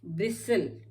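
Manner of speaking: normal talking voice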